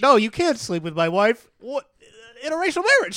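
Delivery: high, loud, hammed-up outrage; mock outraged Tom. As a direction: parody a spluttering bigot. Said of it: "He's literally like".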